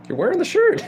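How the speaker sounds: retold high-pitched protest, breaking into laughter; mock incredulous. Teacher figure retorting to kids in band shirts, mock incredulous. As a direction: squeal it in comic disbelief.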